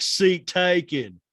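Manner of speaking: very slow, loud, high drawl; hammed-up slow movie-character drawl; Forrest Gump impression. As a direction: draw each word right out like a simple Southerner. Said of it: "very Forrest Gump".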